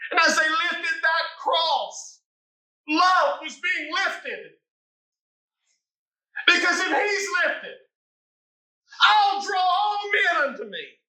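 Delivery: rousing altar-call shout; climactic preaching. Acted. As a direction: lift it up with full voice.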